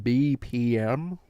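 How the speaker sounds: very slow B P M with a hard B. Shown as a demonstration. Quoting the song's awkward line, hard B in B P M. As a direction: draw out B P M, hitting the B hard. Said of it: "where I hit a hard B".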